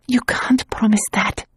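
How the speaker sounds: high whisper, frightened; tearful whisper. A narrator's reading. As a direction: barely breathe it, near tears. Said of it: "she said, her teeth chattering".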